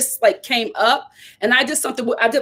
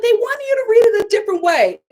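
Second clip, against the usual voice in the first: much higher, careful agent voice; 11 semitones higher, faster than usual. Retold or imitated. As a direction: play the awkward agent. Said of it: "she was like".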